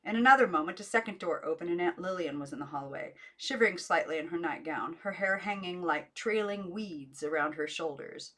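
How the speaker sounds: usual speaking voice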